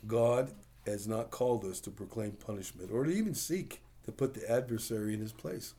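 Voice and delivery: ordinary talk, nothing marked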